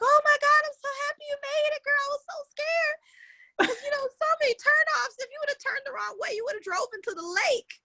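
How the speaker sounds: gushing relieved friend. Retold or imitated. Friend greeting her outside, gushing high with relief. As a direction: gush with relief that she made it. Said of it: "She's like".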